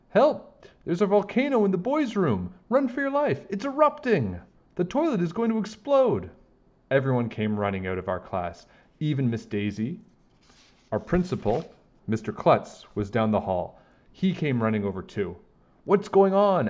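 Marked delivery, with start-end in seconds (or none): 0.1-6.3 high frantic shouts of alarm; panicked yelling. Acted. Boy yelling to the school that the toilet will explode, panicked shouting. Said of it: "started yelling". 15.8-16.7 louder, higher urgent question; principal asking. Acted. Principal asking the boy what's going on, urgent. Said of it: "asked Mister Klutz".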